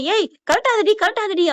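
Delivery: high-pitched urgent warning; retold shout, pitched well up; mock frantic yell. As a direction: call out to stop her in alarm. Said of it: "Chitra எல்லாம் கத்த".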